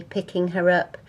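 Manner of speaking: usual speaking voice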